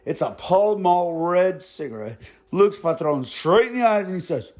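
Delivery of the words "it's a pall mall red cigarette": ordinary delivery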